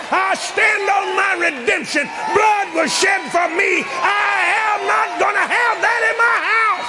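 high, forceful preacher's shout, words stretched; loud defiant declaration. Retold or imitated. Preacher answering the television's warnings, loud defiant declaration. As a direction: shout down the threat with total conviction. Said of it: "I say it out loud".

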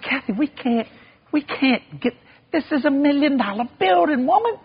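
high-pitched, disbelieving husband; incredulous protest. Retold or imitated. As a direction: balk at it in a squeaky high pitch. Husband balks at the price.

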